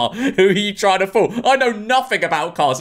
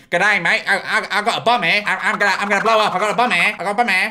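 higher-pitched, hammed-up Australian; awful Australian accent; 3 semitones higher, faster than usual. Voicing a freak at the airport, bad Australian accent. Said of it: "That's an awful Australian accent".

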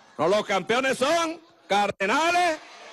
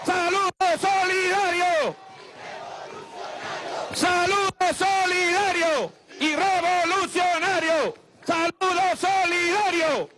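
shouted slogan; 7 semitones higher, slower than usual. Real, not acted.

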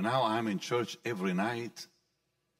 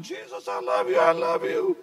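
high, loud devotional outburst; ecstatic cry; 7 dB louder than usual. Retold or imitated. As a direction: cry it out to Jesus, voice soaring. Acts out ecstatic cry to Jesus.

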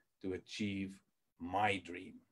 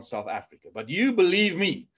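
normal talking voice

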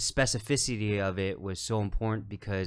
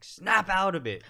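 high, slow, emphatic command; coach's pep talk; 9 semitones higher, 3 dB louder than usual. Retold or imitated. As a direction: imitate a coach jolting someone out of doubt. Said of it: "she was like".

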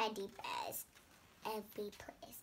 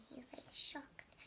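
whisper; 9 dB quieter than usual. Real, not acted.

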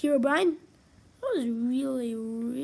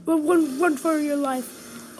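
loud panicked cry, slowing down; terrified horror victim; 3 semitones higher, 7 dB louder, slower than usual. Real, not acted. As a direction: yell in terror while fleeing. Fleeing the monster.

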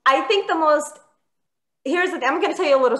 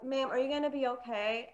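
much softer, gently asked question; concerned firefighter; 10 dB quieter than usual. Retold or imitated. Firefighter checking on a tenant after the fire, softly concerned. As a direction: lightly voice a caring rescuer, hushed.

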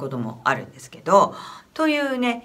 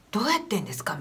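hushed, unvoiced asking; whispered question; 7 dB quieter, faster than usual. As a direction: whisper it in puzzlement. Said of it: "みたいな".

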